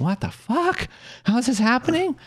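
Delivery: high-pitched disbelief; incredulous. Retold or imitated. Boy thinks as family walks in, incredulous. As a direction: imitate a stunned kid's inner squeal. Boy's amazed thought on getting in.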